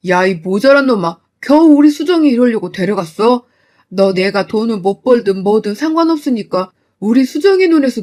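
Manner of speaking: father raging at his son-in-law; furious father. Acted.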